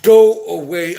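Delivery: sharp, loud brush-off; telling off. Real, not acted. Streamer to an annoying chatter, a loud real brush-off. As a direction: shove the chatter away with your voice. Shooing a troll from chat.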